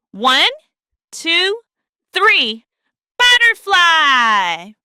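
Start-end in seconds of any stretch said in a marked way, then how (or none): 3.2-4.7 Butterfly called out slow and drawn-out, rising at the end; slow stretched call. Shown as a demonstration. Teacher leading a group call, slow stretched Butterfly. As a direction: stretch it out so the kids can join. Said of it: "say butterfly together".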